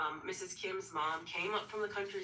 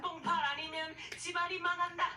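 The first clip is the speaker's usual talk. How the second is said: much higher, very fast melodramatic rant in Korean; dramatic mother-in-law; 10 semitones higher, 14 dB louder, much faster than usual. Retold or imitated. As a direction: overblown doom warning from a fussy mother-in-law. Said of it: "Very dramatically".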